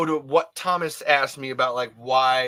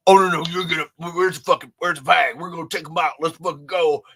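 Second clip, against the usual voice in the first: acted riled-up rabble, a bit louder; mock hostile mob; 3 semitones higher, 6 dB louder, faster than usual. Hostile crowd turning on someone who spoke up, mock riled-up. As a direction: imitate drunks spoiling for a fight.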